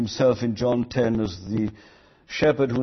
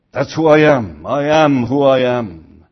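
solemn proclamation; 6 dB louder than usual. Acted. Preacher voicing God's own name.

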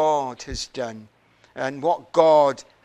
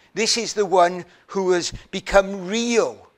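ordinary talk, nothing marked

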